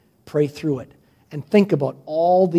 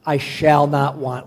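slow, reverent psalm reading; measured phrases with pauses between; 3 dB louder, slower than usual. Real, not acted. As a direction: lead a congregation reading slowly. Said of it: "Let's read it slowly".

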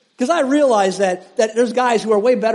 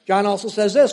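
ordinary talk

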